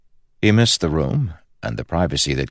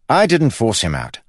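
loud, higher defensive retort; snapping; 8 semitones higher, 5 dB louder than usual. A narrator's reading. Brother answering the guard's protest, snapped. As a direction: snap back in self-defence. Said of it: "snapped Kell".

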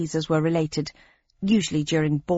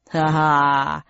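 gloating laugh; 4 semitones lower than usual. Acted. Second host gloats after shooting.

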